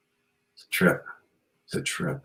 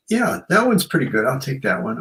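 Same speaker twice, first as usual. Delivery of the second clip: higher, pleased picking at a buffet; chirpy choosing; 7 semitones higher than usual. Retold or imitated. Buffet diner voiced happily picking food, higher. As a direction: play the happy diner choosing dishes.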